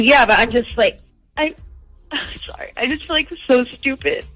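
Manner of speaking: ordinary talk, nothing marked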